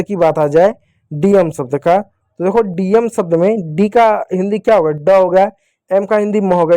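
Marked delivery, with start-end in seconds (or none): none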